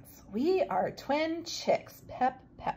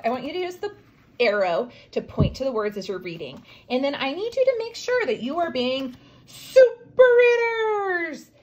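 ordinary delivery

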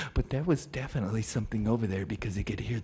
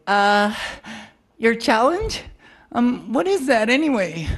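nervous voice; 9 semitones higher, 6 dB louder than usual. Acted. Nervous character asks.

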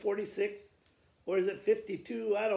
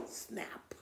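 whispered snap; 12 dB quieter than usual. A narrator's reading. Jaws snap shut.

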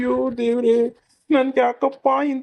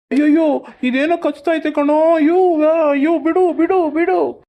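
yelping in pain; 4 semitones higher than usual. Acted. Vendor bitten by crab in pot.

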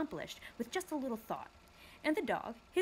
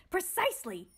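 higher shout; shouting dog; 9 semitones higher, 3 dB louder than usual. Acted. Watchdog praising Milo's insight, higher shout. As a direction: bark out the word. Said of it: "shouted the dog".

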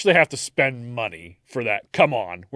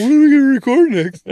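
much higher, louder whiny nagging voice; mock whiny nag; 12 semitones higher, 9 dB louder than usual. Host mocks Dan nagging to record, whiny. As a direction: pester like an impatient kid. Mocking cohost's nagging question.

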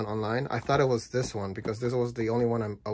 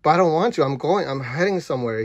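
exasperated refusal; 4 semitones higher, 6 dB louder than usual. Retold or imitated. Arguing with the talking building.

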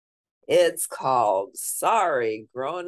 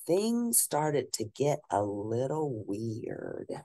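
plain reading voice

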